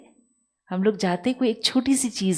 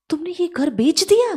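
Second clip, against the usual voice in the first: surprised father; 9 semitones higher, 5 dB louder than usual. Retold or imitated. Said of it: "तो बोलते".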